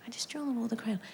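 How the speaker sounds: whispered under the breath. Shown as a demonstration. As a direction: hush it like a secret. Whispered repeat of kid line.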